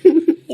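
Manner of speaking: a scornful little laugh; bitter laugh. Acted. Girl mocks the friend's self-blame with a bitter laugh.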